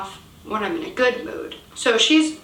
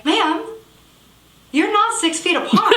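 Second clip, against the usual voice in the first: higher, laughing retort; retold laughing; 7 semitones higher than usual. Jogger to the woman in her face, retold laughing protest. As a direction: act out bursting into laughter as you say it. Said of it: "I burst out laughing and say".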